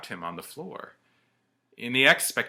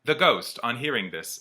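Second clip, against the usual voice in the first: usual speaking voice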